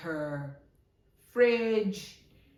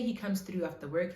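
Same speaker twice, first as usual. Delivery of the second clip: ordinary delivery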